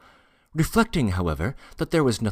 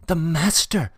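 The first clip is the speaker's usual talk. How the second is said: high, voiced cry of fluster; 10 semitones higher, 4 dB louder than usual. A narrator's reading. Porter warning that the master is coming, flustered. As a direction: repeat the warning higher, in a fluster. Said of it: "the porter whispered in a fluster".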